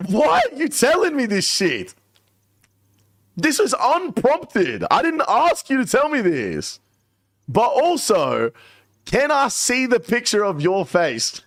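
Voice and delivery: protest, then cave to curiosity, high pitched; exasperated disbelief. Retold or imitated. Asking Skidler why he shared this, high incredulous rant. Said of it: "I'm like".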